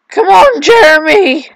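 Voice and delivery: loud, high-pitched urging; exasperated shout. Real, not acted. Player urging Jeremy on, exasperated shout. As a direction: holler it at a useless helper. Urges game character.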